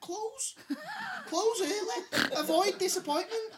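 mock shop notice. Mocking the pizza shop notice.

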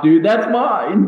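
high, loud, slow disbelief; surprised. Real, not acted. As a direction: blurt it out in shock. Same fact prepared, disbelief.

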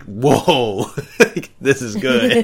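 mock amazed, through laughter; laughing imitation. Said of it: "he's like".

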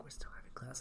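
retold teacher's hushed whisper, barely voiced; whispered quietly. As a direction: whisper it like a teacher mid-lesson. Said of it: "he said very quiet".

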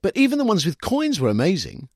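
high, loud, wide-eyed voice; earnest young man. A narrator's reading. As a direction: tell it in earnest wonder. Sam continues earnestly.

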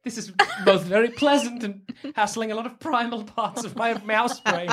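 ordinary delivery

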